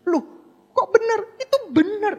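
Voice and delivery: high, loud, half-laughing surprise; surprised exclamation. Real, not acted. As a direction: exclaim in amazed disbelief.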